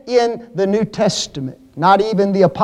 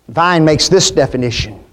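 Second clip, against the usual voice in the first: ordinary talk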